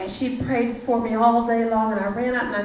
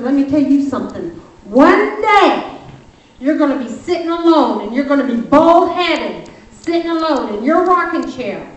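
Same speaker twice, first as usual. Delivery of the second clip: louder, higher forceful warning; retold telling-off; 5 semitones higher, 7 dB louder than usual. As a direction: lay it on him like a final warning. Telling him off as she leaves.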